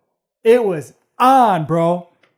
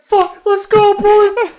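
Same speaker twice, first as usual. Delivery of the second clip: higher, louder mock rallying cry; urgent getaway call; 13 semitones higher, 7 dB louder, much slower than usual. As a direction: hurry the boys out, half laughing. Urging friends to flee fight.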